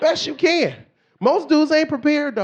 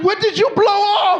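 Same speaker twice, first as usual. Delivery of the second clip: high, raised-voice disbelief; mock shock; 9 semitones higher, 4 dB louder than usual. Husband reacting to his wife's scrubbing, high shocked yell. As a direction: exclaim it in exaggerated alarm.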